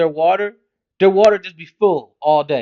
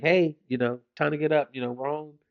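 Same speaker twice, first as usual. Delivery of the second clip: much softer, low and tender; gentle wake-up; 3 semitones lower, 9 dB quieter than usual. Retold or imitated. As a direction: coax a sleeping kid awake softly. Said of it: "I wake you up nicely".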